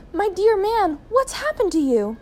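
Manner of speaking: high-pitched, horrified wife; aghast, pitched well up; shocked cry. A narrator's reading. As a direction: cry it out in alarm. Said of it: "cried Missus Gregg".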